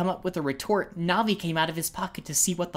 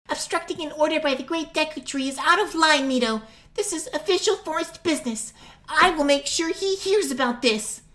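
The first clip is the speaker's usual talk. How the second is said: scolding fairy; 11 semitones higher, 3 dB louder than usual. Acted. Navi scolding Mito officially.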